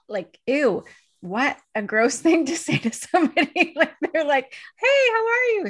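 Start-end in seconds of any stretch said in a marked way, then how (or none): 0.5-3.7 loud, higher-pitched ew of distaste; disgusted. Retold or imitated. Speaker recoiling at hearing herself say she's busy, disgusted. Recalls disgust at own words. 4.8-5.7 very high, loud, gushing hello; mock cheery greeting. Acquaintance greeting someone in passing, mock cheery.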